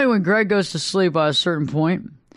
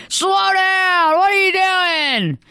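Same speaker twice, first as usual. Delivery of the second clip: alarmed spouse shouting; 10 semitones higher, much slower than usual. Retold or imitated. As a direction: scream it like a spouse jolted awake in the car. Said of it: "And they're like".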